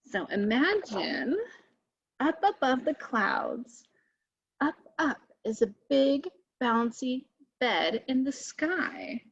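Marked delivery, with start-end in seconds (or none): none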